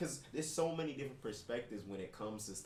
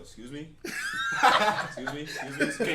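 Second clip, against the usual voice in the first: building excuse me; 2 semitones higher, 10 dB louder than usual. Retold or imitated. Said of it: "you like".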